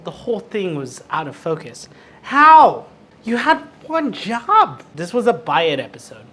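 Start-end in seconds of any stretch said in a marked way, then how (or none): 2.2-4.8 high, exasperated cry, then drawn-out reproach; indignant outburst. Real, not acted. Host berating the out-of-focus camera, indignant outburst. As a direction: burst out loud, then stretch the complaint.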